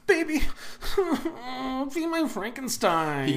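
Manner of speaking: a laughing, corrected Joaquin Phoenix line; Joaquin Phoenix impression. Friend correcting a bad impression, laughing Joaquin Phoenix voice. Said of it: "It's more like".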